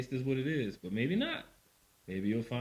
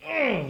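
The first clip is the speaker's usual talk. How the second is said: disgusted ugh; 13 semitones higher, 8 dB louder than usual. Retold or imitated.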